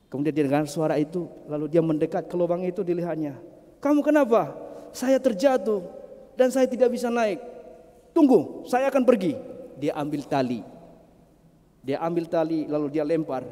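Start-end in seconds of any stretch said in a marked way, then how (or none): 3.8-4.6 calling down. Acted. Second passer-by calls down. 4.9-7.4 pleading answer. Acted. Trapped man answers. 8.2-9.4 calling reply. Acted. Second passer-by replies.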